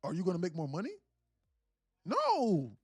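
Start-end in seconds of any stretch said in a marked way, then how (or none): 2.0-2.7 high, sarcastic one-word answer. Pretend. Streamer mocking a viewer's question with a high sarcastic no. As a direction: scoff the no way up high. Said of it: "I just break things down simply in a sarcastic way".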